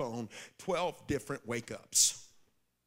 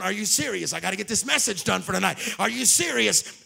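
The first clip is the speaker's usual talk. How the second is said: exasperated disbelief; 4 semitones higher, 8 dB louder than usual. Retold or imitated. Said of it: "I'm going".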